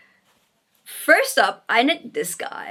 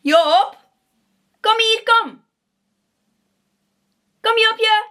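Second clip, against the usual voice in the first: high, loud call to a pet; high-pitched cat-summoning call; 11 semitones higher, 5 dB louder than usual. Real, not acted. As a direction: call out for your cat to come. Calling the cat over.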